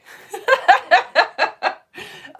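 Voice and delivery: loud, high peals of laughter. Real, not acted. Teacher joking about absent pupils at Disneyland, high laugh. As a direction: burst into high staccato laughter.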